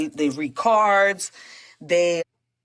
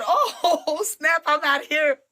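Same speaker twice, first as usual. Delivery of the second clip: laughing exit; 7 semitones higher than usual. Retold or imitated. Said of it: "So I said".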